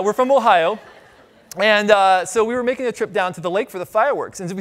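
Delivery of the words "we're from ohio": Ohio announced louder with pride; louder, proud restatement. Real, not acted. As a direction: say it again louder over the crowd, owning it. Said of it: "No whoops".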